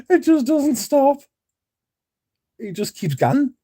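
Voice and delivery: said through laughter, high, loud and slow; laughing. Real, not acted. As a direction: keep laughing through the words. Still laughing at the clip.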